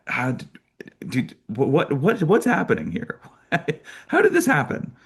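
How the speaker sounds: stammering, high-pitched disbelief; incredulous, pitch climbing to a squeak; mock bafflement. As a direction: act out being lost for words. Acted bafflement at freak luck.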